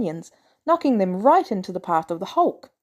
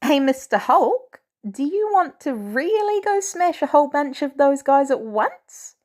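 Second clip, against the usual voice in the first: put-on enthusiastic kid; 6 semitones higher than usual. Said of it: "enthusiasm in the kid's voice".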